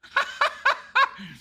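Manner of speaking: real amused cackle; high laugh. Real, not acted. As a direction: burst of high-pitched laughter. Laughing at streamer's blunder.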